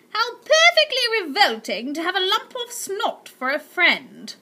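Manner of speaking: high, loud, sneering remark; disgusted aunt. Acted. Aunt Greta mocking the boy's friend, high and sneering. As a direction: drip with disgust. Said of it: "remarked Aunt Greta".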